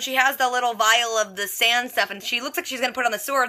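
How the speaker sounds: ordinary talk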